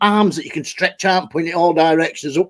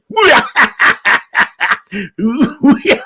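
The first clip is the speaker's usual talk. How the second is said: wheezing laugh; 3 semitones higher than usual. Real, not acted. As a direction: laugh till you wheeze. Laughing at avatar.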